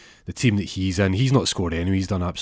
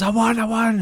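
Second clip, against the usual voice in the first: high, loud cry of joy; excited cheer; 5 dB louder than usual. Retold or imitated. Peter celebrating his winning shot, excited shout. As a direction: shout the win to the world. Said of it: "And Peter goes".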